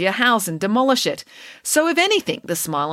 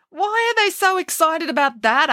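higher voiced puzzled girl; 12 semitones higher, 4 dB louder than usual. April baffled by the class, a puzzled question. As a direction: ask it baffled, as young April.

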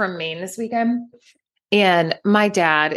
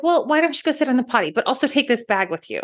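rushed mom; 5 semitones higher, much faster than usual. Retold or imitated. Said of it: "And I was like".

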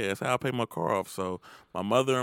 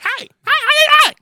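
very high, loud cartoon shouting; hammed-up high cartoon yelling; South Park characters; 17 dB louder than usual. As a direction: squawk it like a goofy cartoon Canadian. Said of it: "talk like those South Park characters".

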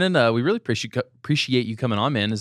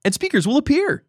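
louder, higher excited sales pitch; hyped ad pitch; 8 semitones higher, 4 dB louder than usual. Pretend. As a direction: sell it like a TV ad. Ad bit again.

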